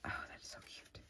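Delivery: breathy awed whisper. Real, not acted. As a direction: whisper in awe. Awed by a cute item.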